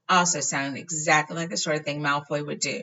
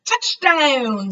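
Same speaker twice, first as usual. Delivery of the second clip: high, loud cheer; Fred triumphant; 10 semitones higher, 9 dB louder than usual. A narrator's reading. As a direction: announce the landing proudly. Fred announcing landing.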